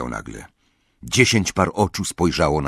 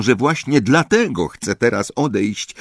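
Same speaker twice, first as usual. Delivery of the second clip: usual speaking voice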